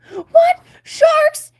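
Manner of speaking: very high, loud terrified cry; panicked shriek. Acted. Flounder hearing about sharks, high loud panic. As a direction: shriek in terror.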